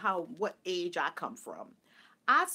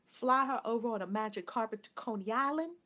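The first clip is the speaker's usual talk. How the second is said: usual speaking voice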